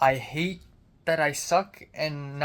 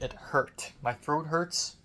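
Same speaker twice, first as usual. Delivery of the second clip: normal talking voice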